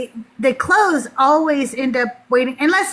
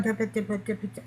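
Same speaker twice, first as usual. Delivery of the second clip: rapid, hushed muttering to oneself; inaudible mumbling; 4 semitones lower, 11 dB quieter, much faster than usual. Retold or imitated. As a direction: imitate someone tallying under their breath, too soft to catch. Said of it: "I couldn't hear what she was saying".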